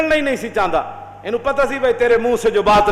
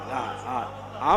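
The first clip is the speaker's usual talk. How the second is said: laughing; 9 semitones lower, 9 dB quieter, much slower than usual. Real, not acted. Preacher laughs before couplet.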